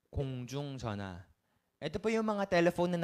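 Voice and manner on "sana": ordinary talk, nothing marked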